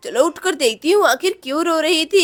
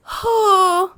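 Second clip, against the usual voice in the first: acted yawn. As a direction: yawn while settling back to sleep. Yawn on going back to sleep.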